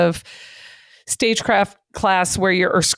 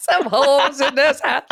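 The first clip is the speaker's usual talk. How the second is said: laughing; 6 semitones higher than usual. Pretend. Repeating the line, laughing.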